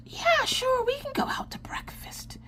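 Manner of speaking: squeaky high "sure" trailing into a whisper; fake-cheerful yes, fading to a hush; mock sweet agreement. Telling husband yes to breakfast, in a squeaky mock-sweet whisper.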